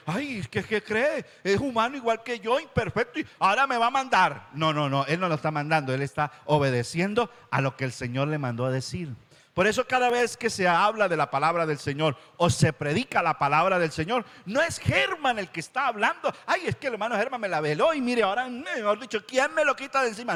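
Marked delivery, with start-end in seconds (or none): 0.1-4.5 higher, sneering complaint; scornful church member. Retold or imitated. Preacher imitates a member scoffing at the pastor, mocking. Voicing a believer who resents the pastor. 16.5-20.4 higher, fast whiny grumble; whining church member. Retold or imitated. Preacher mimics a member grumbling about pastor Germán, whiny. Mocking a believer blaming the pastor.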